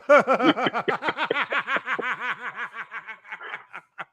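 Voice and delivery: long rapid cackle, trailing away; real laughing fit. Real, not acted. Host cracks up at co-host's echo of his line, laughing hard. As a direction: keep laughing until the breath runs out.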